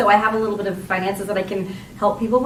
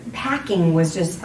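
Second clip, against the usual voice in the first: normal talking voice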